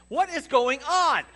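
loud, high and slow astonished cry; shocked disbelief. Retold or imitated. Roommate in disbelief at his plan, loud shocked cry. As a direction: blurt it out in disbelief.

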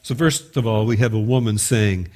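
ordinary talk, nothing marked

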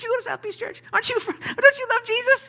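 very high squeaky mock questioner; nosy accuser. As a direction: pipe up in a tiny falsetto, accusing. Voices the person questioning Peter.

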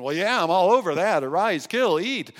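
loud, keen shout of a hunter; mock hunter shouting with glee; eager hunter. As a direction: bellow it like a hunter who can't wait. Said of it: "you hunters out there are saying".

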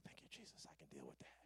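hushed, low thankful aside; barely audible mock-relieved prayer; relieved whisper. Relieved private prayer, retold.

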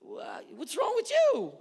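loud, very high, drawn-out retort; defensive comeback. Acted. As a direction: throw the challenge right back, indignant. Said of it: "The next one you're like".

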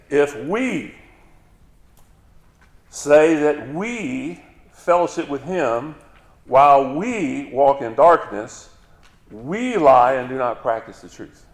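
slow, loud sermon delivery; forceful preaching. Acted. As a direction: hammer the verse home to the church. Rereads the verse forcefully.

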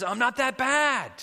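high, drawn-out, loud protest; indignant small voice. Acted. Hidden maggot insisting to the hiker, indignant. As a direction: stretch it out like an affronted little maggot. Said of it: "the voice sounds a little indignant".

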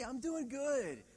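high, slow and loud; rousing call. Shown as a demonstration. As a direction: lift the crowd's voice up. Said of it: "you say".

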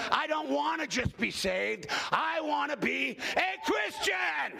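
slow, building preacher's declaration ending in a shout; preaching. Acted. Preacher telling the congregation what he wants, building slowly to a shout.

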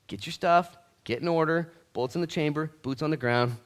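loud, high battle-cry sermon; rally the troops from the pulpit; rallying preaching. Acted. Pastor calling believers to war, loud rallying cry.

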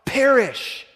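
the word Perish called out high and loud; a sudden loud Perish; emphatic answer. Acted. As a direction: hit "Perish" hard and high, falling. Congregation answer, pastor fills in.

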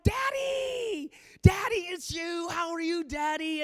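put-on high, delighted child's squeal, easing down; excited little kid. Little kid to daddy on the phone, excited cry. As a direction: shriek the first word like a thrilled kid.

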